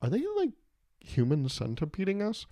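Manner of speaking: squeaky appalled question; appalled animal. Pretend. Feuding farm animals horrified in the afterlife, squeaky voice. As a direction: gasp it out as a horrified bird. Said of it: "they were like".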